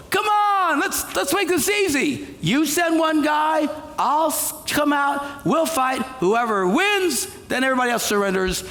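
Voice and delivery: lightly voiced giant taunting; giant's taunt. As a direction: call out across the valley like a bully. Goliath taunting the Israelites.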